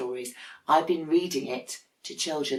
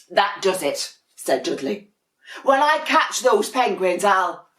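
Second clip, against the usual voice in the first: louder, boiling over; threaten, then cut yourself off; cross penguin; 4 semitones higher, 6 dB louder than usual. Acted.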